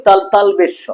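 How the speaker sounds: mispronounced "তালবেশ স", slurred into tal-besh; ভুল উচ্চারণ. Shown as a demonstration. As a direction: say "তালবেশ স" as the common wrong name. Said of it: "অনেক বর্ণই ভুল উচ্চারণ করি". ("তালবেশ স" ভুলভাবে উচ্চারণ)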